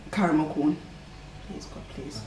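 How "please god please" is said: breathy whisper, pleading; whispered plea. Real, not acted. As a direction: whisper a desperate prayer. Praying for a good flavour.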